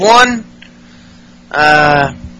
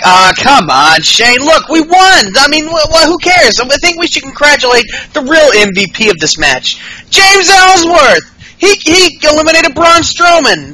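mock hype; 8 semitones higher than usual. Ambrose voiced cheering Ellsworth.

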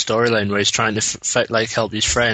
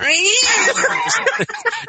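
high squealing laugh. Real, not acted. Laughing at scared exit.

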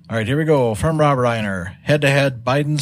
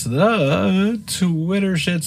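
drawn out title; 7 semitones higher, much slower than usual. Acted. Host closes segment drawn out.

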